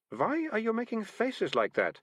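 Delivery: put-on slow suspicious girl voice; girl questioning the cat; Malicia's voice.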